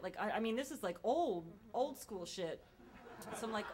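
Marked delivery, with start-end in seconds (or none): none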